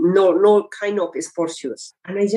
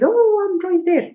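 happy relief; 14 semitones higher than usual. Retold or imitated. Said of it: "I was so happy".

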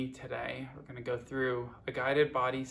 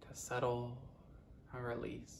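guided meditation; 7 dB quieter than usual. Acted. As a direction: guide them unhurriedly, letting each phrase settle. Leads a guided body scan.

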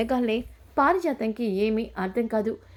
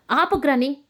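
loud, raised cry to stop; angry stop; 3 semitones higher, 5 dB louder than usual. A narrator's reading. Granddaughter cuts off her grandmother with an angry shout. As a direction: cut her off hard.

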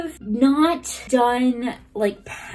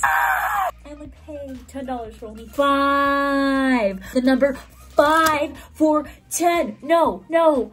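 slow, louder, higher shouted complaint; outraged shout; 4 semitones higher, 3 dB louder, slower than usual. Real, not acted. Fuming to viewers about coffee prices, an outraged shout. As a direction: yell the price like it's a scandal. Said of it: "I'm screaming".

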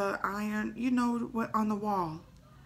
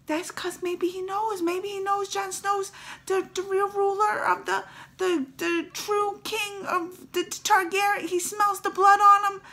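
awed realization; 11 semitones higher, 6 dB louder than usual. Retold or imitated. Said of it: "I was like".

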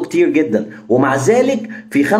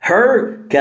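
usual speaking voice

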